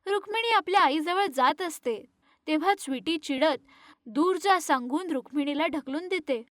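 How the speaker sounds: usual speaking voice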